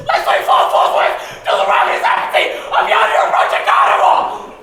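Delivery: very high, loud screaming plea; hysterical shout. Acted. Pigs scream their plea at pig three. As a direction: shriek it nearly hysterical. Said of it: "on the verge of hysteria".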